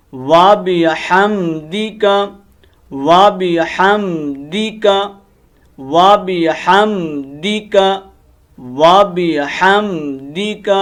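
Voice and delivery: و بحمدک spoken very slowly, each syllable held; slow model recitation. Shown as a demonstration. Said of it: "تلفظی غلطیاں set کرتے ہیں". (ہر لفظ کو آہستہ اور صاف پڑھیں)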